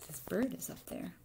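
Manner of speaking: ordinary delivery, nothing marked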